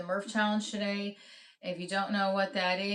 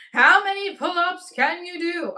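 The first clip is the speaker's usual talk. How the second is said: louder, higher repeat; excited shout; 10 semitones higher, 12 dB louder than usual. Real, not acted. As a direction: call it out to the chat. Repeats poll question louder.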